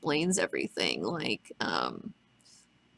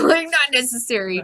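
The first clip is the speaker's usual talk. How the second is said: laughing, higher and louder mock repeat; amused mimicry; 5 semitones higher, 5 dB louder than usual. Host repeats Rorschach's line on computers, amused mimicry. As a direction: repeat it through a laugh, voice lifted.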